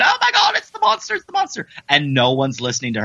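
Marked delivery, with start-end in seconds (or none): 0.0-1.7 squeaky high alarm; shrill comic panic; panicked maid. Retold or imitated. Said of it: "she's telling everyone".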